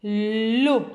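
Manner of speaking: L'eau, read out slowly and clearly as one syllable; syllable read aloud. Shown as a demonstration. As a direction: model the syllable for children. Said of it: "je le lis". (syllabe l'eau lue clairement)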